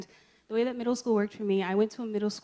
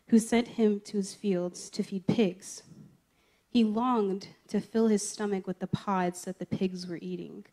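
louder, measured Bible reading; scripture reading; 6 dB louder than usual. Acted. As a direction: read the passage out to the group. Reads the parable aloud.

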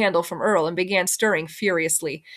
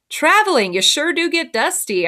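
higher, pointedly projected remark; loud voice; 7 semitones higher than usual. A narrator's reading. Child says it loudly for Mama to hear, loud voice. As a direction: say it up and out so it carries. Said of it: "said in a loud voice".

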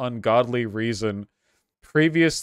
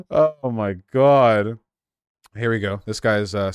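ordinary talk

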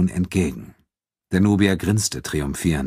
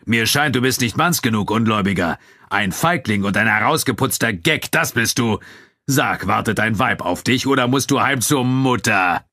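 raised mocking taunts; Abu Dun jeering; 4 semitones higher than usual. A narrator's reading. Nubian fighter insults his friend before spectators, jeering. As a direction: pile on insults to provoke him. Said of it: "höhnte Abu Dun".

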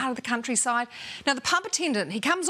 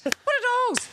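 put-on high-pitched comic Irish accent; 12 semitones higher, slower than usual. Pub attendant puzzled by fallen tees, Irish accent. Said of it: "shall I do the Irish accent".

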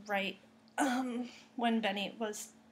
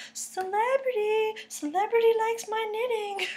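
starstruck squeal; 11 semitones higher than usual. Retold or imitated. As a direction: gush like a starstruck fan. Mock starstruck squeal about celebrity.

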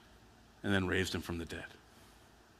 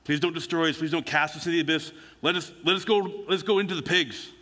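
higher, pleading voice that climbs; begging demons; 7 semitones higher, 3 dB louder than usual. Retold or imitated. Demons pleading with Jesus not to be destroyed, high desperate begging. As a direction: beg for your life like a cornered demon. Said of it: "Demons begin to beg for their life".

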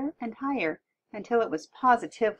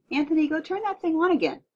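raised, high-pitched fatherly order; giving an order; 9 semitones higher, 5 dB louder than usual. A narrator's reading. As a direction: order the kid across the room. Father orders son to fix TV.

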